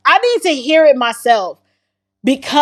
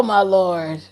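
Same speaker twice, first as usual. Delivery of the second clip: usual speaking voice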